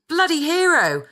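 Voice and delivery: mock shout of praise, pitched well up; crowd shout. A narrator's reading. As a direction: cheer like an onlooker leaning over the wall. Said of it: "shouting things like".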